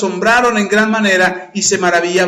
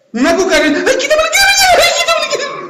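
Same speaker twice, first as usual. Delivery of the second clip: high loud laughing shriek to get a cockroach off; hammed-up panic at a cockroach; panicked fear; 5 dB louder than usual. Shown as a demonstration. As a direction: squeal as if a cockroach crawled on you. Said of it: "denme una voz de miedo".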